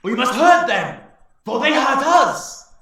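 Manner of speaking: high, loud, slow call for revenge; a warrior orc pushing forward to demand revenge; angry orc warrior. Acted. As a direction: demand vengeance fiercely.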